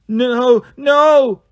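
high, loud panicked no; frightened cry. Acted. Harpy Ella cries out as her sisters attack, panicked.

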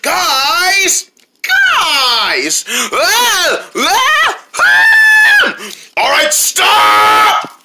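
high, loud scream to stop the fight; breaking up friends' fight with a high shout. Acted. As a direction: yell over everyone to stop them.

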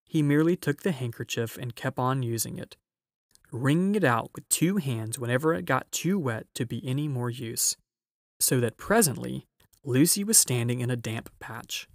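plain reading voice

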